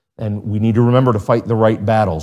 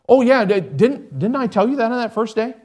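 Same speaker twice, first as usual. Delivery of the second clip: careless teacher; 10 semitones higher than usual. Retold or imitated. Teacher voiced in story.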